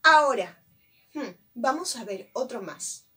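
ordinary delivery

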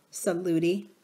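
plain reading voice

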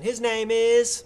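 slow build to a held last word; drawn-out announcement. Real, not acted. Host trying the artist intro again, drawn-out announcement. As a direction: announce it firmly, holding "is" on one note.

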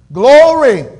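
glory belted out high and loud at the peak; shouted glory. Real, not acted. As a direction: let glory ring out like the sermon's climax. Preacher's climax, shouting glory.

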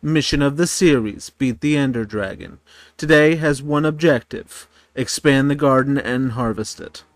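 loud upbeat series intro; energetic intro. Acted. Gamer opening his video with a punchy intro. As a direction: announce the episode like a host.

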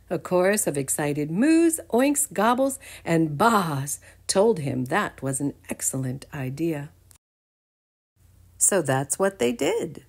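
ordinary delivery, nothing marked

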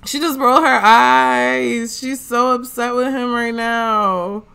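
loud, high play-by-play of the drama; excited. Real, not acted. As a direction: call it out like juicy gossip. Reacting to the Sim's eye roll.

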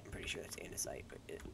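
low hushed whisper. Real, not acted. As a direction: whisper it to yourself. Whispered guess.

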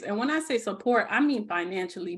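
normal talking voice

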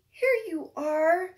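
ordinary delivery

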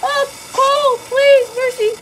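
high, loud begging for mercy; pleading scream. Real, not acted. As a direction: beg for mercy in panic. Begging during horror game chase.

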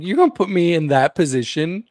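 mock outrage at being put on the spot; pissed off. Imagining being that photographer, pissed off. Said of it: "I would be so pissed off".

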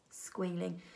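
usual speaking voice

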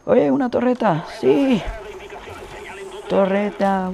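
eager outburst; excited. Real, not acted. Spots a turret mid-game.

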